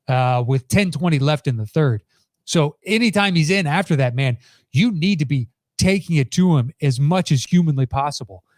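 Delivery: ordinary talk, nothing marked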